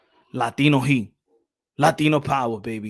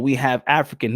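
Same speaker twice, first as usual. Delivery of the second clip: normal talking voice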